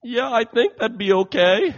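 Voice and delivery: high, loud acceptance after crying; imitated tearful recovery. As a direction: play a daughter pulling herself out of tears. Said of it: "she wipes away the tears".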